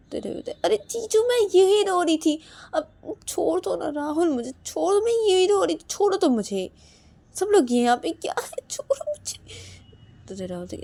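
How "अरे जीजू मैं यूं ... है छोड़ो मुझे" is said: high-pitched flustered protest; whiny pleading. Acted. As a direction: beg to be let go, starting loud and high. Pleading to be released. (ऊँची आवाज़ में रिरियाती मिन्नत)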